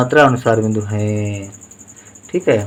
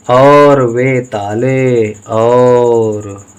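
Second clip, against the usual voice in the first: very slow, stretched out; drawn out syllables; 3 dB louder, much slower than usual. Shown as a demonstration. Teacher dictating a Hindi word to pupils, drawn out slowly. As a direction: sound it out slowly for the class. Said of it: "teacher sounds out word".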